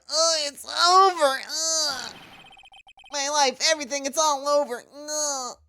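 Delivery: high-pitched, wailing weasel of a client; whiny voice. Acted. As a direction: whine in despair, way up high. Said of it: "That's my whiny voice".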